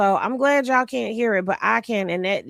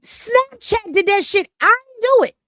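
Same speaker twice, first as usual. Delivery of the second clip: high, louder put-on voice pleading innocence; squeaky mock defence, much higher and louder; mock innocent protest; 10 semitones higher, 5 dB louder than usual. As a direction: jump way up in pitch and protest it wasn't you. Mock denial blaming Snapchat.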